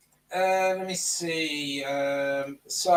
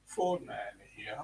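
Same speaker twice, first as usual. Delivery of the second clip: deep Fordman voice; 6 semitones lower, 7 dB quieter than usual. Retold or imitated. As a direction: push the voice down into the chest. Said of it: "comes up with a much deeper voice than I can actually do it".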